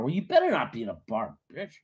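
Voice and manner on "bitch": ordinary talk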